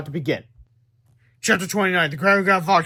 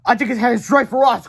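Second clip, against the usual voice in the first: higher, louder worried cry; cried; 7 semitones higher, 7 dB louder than usual. Acted. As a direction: alarm in a hurry. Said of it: "cried yesterday, George".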